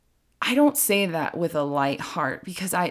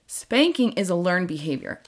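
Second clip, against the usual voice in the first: ordinary delivery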